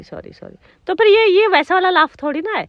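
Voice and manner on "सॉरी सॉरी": सॉरी, सॉरी breathed out as a whisper; whispered sorry. Real, not acted. Mother apologizes to upset child. (फुसफुसाकर सॉरी, सॉरी कहना)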